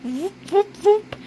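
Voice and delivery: quick repeated "voop" noises; voiced sound effect. A narrator's reading. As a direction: imitate a little machine whooping. Imitated machine noise in story.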